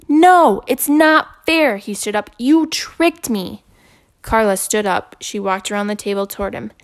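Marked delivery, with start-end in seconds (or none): 0.1-1.8 put-on high, loud, slow child's protest; a boy yelling at his teacher that it's unfair; angry boy. Boy stands up shouting. 2.4-3.6 hurt accusation. Acted. Boy accuses teacher.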